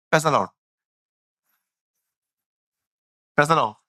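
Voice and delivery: "पैसा लाओ" slurred like praise the Lord; "पैसा लाओ" said like a hurried blessing; mock "praise the lord" as "paisa lao". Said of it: "इनका "Praise the Lord" है".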